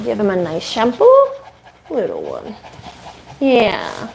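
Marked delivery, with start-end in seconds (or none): 1.9-2.5 soft coo. Real, not acted. Cooing to the dog.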